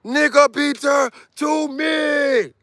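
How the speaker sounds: high, slow drawn-out shout; mock summoning shout. Mechanic summoning his hammer like Thor, long high shout. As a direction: call the hammer to your hand.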